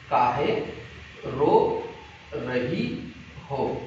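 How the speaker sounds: "काहे", "रो", "रही हो" slow and separated by pauses; word by word. Shown as a demonstration. Teacher breaking down the memory phrase for pupils, word by word.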